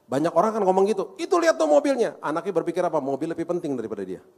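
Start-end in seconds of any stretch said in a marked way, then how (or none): 1.2-2.2 high, loud told-off outburst; sharp, raised-voice reproach; mock scolding parent. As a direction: imitate a parent scolding over damage.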